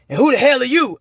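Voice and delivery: mock grumpy dad barking at his son; lightly voiced grouchy father; big scruffy voice. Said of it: "He's like".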